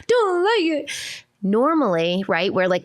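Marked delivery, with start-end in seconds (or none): 0.0-0.9 high, drawn-out mock wail; imitating a child's tattling cry. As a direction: whine it like a tearful little kid. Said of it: "she's crying and".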